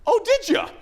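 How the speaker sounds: mock surprised reply. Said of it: "And I went".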